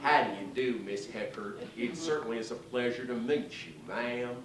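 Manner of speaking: a courtly Southern president; Lyndon Johnson. Retold or imitated. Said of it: "As she got to L B J".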